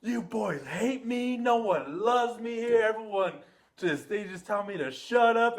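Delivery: high, loud, drawn-out mock wailing; mom's hurt outburst. Mom blowing up at her boys after holding it in, hammed-up hurt outburst. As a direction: imitate an overwhelmed mother blowing up, hammed-up.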